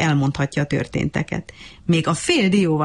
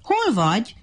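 calling out; 7 semitones higher than usual. A narrator's reading. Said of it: "kiabált".

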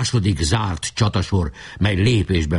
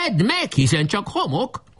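high taunting call; shouting; 12 semitones higher than usual. A narrator's reading. Boy taunts the enemy chief, high shout. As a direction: throw it out as a high-pitched cry. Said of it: "kiáltotta".

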